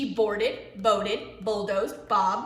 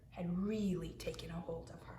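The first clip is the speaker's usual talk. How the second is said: soft whisper; hushed storybook whisper; 4 semitones lower, 13 dB quieter, faster than usual. A narrator's reading. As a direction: breathe it like a secret. Whispered narration and aside.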